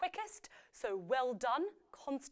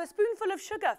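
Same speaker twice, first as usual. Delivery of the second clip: excited reveal; 10 semitones higher, 8 dB louder than usual. Acted. Announcing the right answer.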